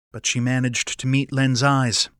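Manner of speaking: plain reading voice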